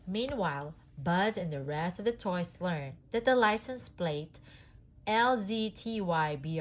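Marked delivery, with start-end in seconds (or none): none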